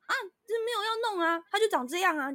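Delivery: high, whiny mock landlady; landlady's whine. Mimicking the landlady brushing off the request.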